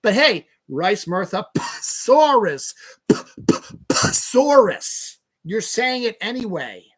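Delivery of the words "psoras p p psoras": Psoras with a hard, popped P before the S; exaggerated P. Shown as a demonstration. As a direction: spit out the P in Psoras, repeating it slowly. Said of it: "there's a P in front of the S".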